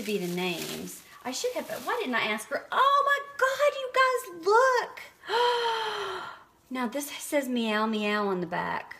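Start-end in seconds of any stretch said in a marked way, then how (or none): 2.7-5.0 high, loud delighted squeal; excited squeal. Real, not acted. Thrilled at a pretty balm. 5.0-6.4 sharp high gasp of delight. Real, not acted. Thrilled at a pretty balm.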